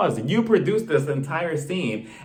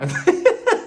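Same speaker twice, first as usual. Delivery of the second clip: high, louder laugh breaking out; bursting laugh; 5 dB louder than usual. Real, not acted. Laughing at her own husband impression. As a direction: crack up at the joke.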